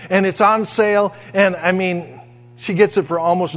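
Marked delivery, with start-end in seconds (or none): none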